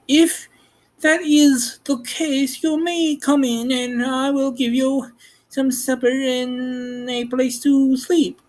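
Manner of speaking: high, wary farm woman. Acted. Woman at door to strange visitors, wary and high.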